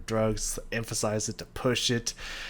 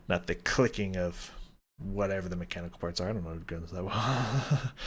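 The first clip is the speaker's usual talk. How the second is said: ordinary talk, nothing marked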